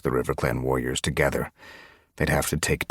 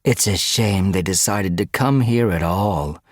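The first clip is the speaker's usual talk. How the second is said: higher-pitched mock regret; taunting; 4 semitones higher, 4 dB louder than usual. A narrator's reading. Guard goading the stranded cats, taunting. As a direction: needle them with false pity. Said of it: "Brackenpelt taunted".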